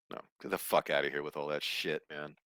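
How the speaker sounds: ordinary talk, nothing marked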